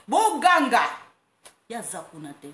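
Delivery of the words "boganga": high loud called-out word; mock crowd shout. She voices the crowd shouting, high and loud.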